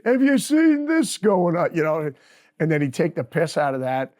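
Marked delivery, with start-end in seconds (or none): 0.0-1.7 much higher, louder and slow mock outrage. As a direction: ham up the disbelief like a joking rocker. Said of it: "you know, like".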